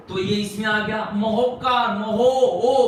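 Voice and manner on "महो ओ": महो, ओ with the ओ pulled out and louder; sound demo. Shown as a demonstration. Teacher isolating the ओ in महोपकार, loud and stressed. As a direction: cut महोपकार at महो and hit ओ. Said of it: "बीच में sound को, यानी उसके उच्चारण को".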